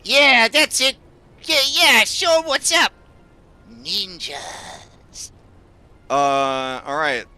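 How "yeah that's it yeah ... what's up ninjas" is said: high cartoon shark egging on a fight; squeaky cheering sidekick voice; small shark voice. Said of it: "the smaller shark says".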